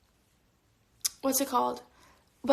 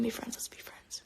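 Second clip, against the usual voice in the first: whispering; 13 dB quieter than usual. Real, not acted. Softly offering friendship to viewer.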